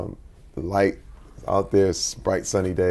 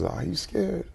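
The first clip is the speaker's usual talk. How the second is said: softer, caring "Are you scared?" with a rise; the right gentle way; 7 semitones higher, 4 dB quieter than usual. Shown as a demonstration. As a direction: ask "Are you scared?" tenderly, no pressure. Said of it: "I wanted to make sure I said that right".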